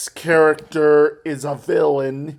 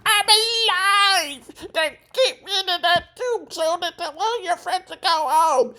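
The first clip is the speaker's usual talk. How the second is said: Squidward yelling. Retold or imitated. Retelling the cartoon, Squidward yells at SpongeBob high. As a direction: bellow it like an offended Squidward. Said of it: "Squidward yells".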